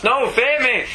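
loud, high villain demand; shouting. Retold or imitated. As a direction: bellow it like an angry villain. Magneto demands fear.

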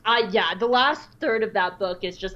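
normal talking voice

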